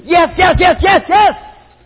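high, loud shouted yeses; excited shouting. Pretend. Preacher showing the congregation how to praise, shouting. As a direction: jump up shouting yes for joy. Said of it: "get all excited and stand up and praise".

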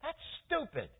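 indignant refusal, jumping high in pitch; acted huffy protest, voice leaping up; upset Naaman. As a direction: balk at it in acted disgust. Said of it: "Naaman got all upset".

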